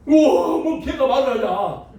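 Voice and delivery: loud wow, then high drawn-out disbelief; amazed. Pretend. Pastor reacts to his pet suddenly talking, amazed. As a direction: exclaim in hammed-up astonishment.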